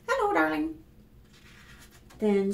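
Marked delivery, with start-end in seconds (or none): 0.1-0.8 sweet greeting. Acted.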